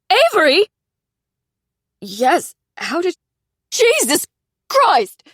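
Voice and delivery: high, loud, near-hysterical outburst; half-screaming with relief and panic; on the edge of hysteria. Acted. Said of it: "high-pitched, on the edge of hysteria".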